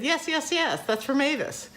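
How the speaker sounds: very high, excited imitation; retold squeal of agreement; mock eager agreement.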